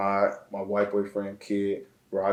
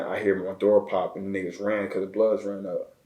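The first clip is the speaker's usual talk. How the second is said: ordinary delivery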